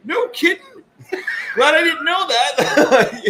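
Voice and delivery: high, loud, slow, laughing; mock clueless squeal; ditzy squeal. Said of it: "she's like".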